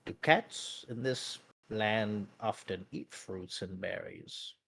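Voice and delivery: slow, low and very soft oblivious question; Meepo. Acted. Meepo looks over and asks about the cat, oblivious. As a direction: puzzle it out quietly and slowly, missing the cue. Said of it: "Meepo not understanding any of these social cues".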